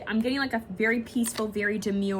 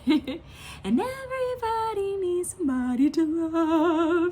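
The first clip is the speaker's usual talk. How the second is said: slow, higher, dramatic recital; dramatic lyric quote; 7 semitones higher, much slower than usual. Retold or imitated. Quoting [name]'s lyric to viewers, slow and dramatic. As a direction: stretch it out like a heartfelt plea. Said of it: "He's so dramatic in that".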